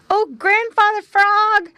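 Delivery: high, loud breathless call; two young animals calling to the old frog; breathless shout. Acted. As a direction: shout it as you arrive panting. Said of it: "they shouted as they came up quite out of breath".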